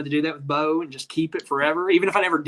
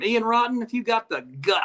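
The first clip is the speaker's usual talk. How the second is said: higher-pitched mock wrestling challenge; playful promo voice, pitched up; Terry Funk promo; 7 semitones higher than usual. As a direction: put on a veteran wrestler calling out a rival. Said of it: "I'll cut a Terry Funk promo".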